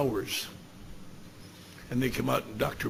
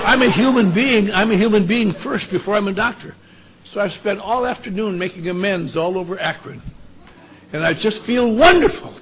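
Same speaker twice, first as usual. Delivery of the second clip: raised, emphatic, elated testimony; excited revelation; 4 semitones higher, 6 dB louder than usual. Retold or imitated. Sober doctor tells his friends of his amends, elated. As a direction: pour it out higher and louder, thrilled.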